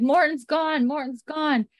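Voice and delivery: high-pitched imitation of urgent calling; mock alarmed cry. Podcaster retelling men announcing an escape, mock alarm. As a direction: raise the alarm, playfully acted.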